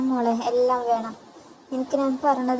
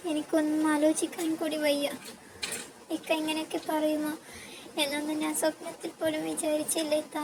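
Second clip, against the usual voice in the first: grieving; 6 semitones higher, 6 dB quieter than usual. A narrator's reading. Abandoned wife grieving to sister.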